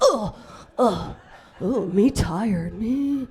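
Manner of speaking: slow, loud dumb caveman talk. Retold or imitated. Comic playing a caveman, slow loud grunting. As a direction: plod each word like a caveman. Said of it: "Like a caveman".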